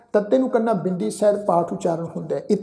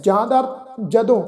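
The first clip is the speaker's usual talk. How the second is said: ordinary delivery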